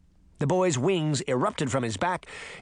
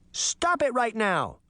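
put-on high, slow little-girl command; demanding; 8 semitones higher than usual. A narrator's reading. Daphne standing before the wolf, demanding. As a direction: demand it, stretching the words. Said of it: "she demanded".

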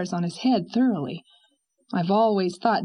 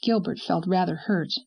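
usual speaking voice

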